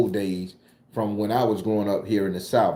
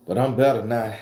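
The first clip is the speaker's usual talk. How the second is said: usual speaking voice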